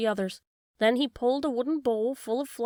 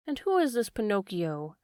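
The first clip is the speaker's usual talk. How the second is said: plain reading voice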